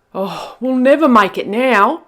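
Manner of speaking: loud wailing despair; despairing Skip. Acted. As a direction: wail it hopelessly. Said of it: "said Skip".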